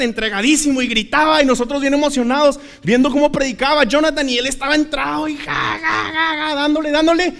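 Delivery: high-pitched imitation of fervent preaching; impassioned preaching. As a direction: build up like a preacher carried away. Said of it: "con una pasión predicando así fuerte".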